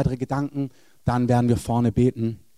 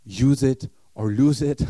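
English saying; slower than usual. Retold or imitated. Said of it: "Es gibt diesen Satz".

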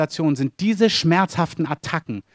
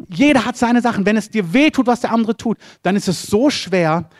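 full-force preaching; 7 semitones higher than usual. Acted. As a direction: preach it to the congregation with rising fervour. Preacher builds to a climax.

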